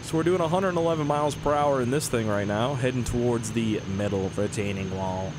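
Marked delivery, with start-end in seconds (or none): 4.0-5.3 flat robotic monotone. Shown as a demonstration. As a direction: say it like a machine. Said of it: "Gotta say it like a robot".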